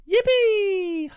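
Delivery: very high, loud falsetto yippee; mock cheer. Streamer cheering for the competition winners, high falsetto squeal. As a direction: squeal a cartoonish celebration.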